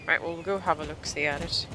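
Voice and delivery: ordinary talk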